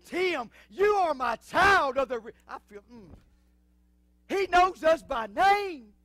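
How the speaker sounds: loud, high preacher's cry, slowing at the end; full-force preaching. Acted.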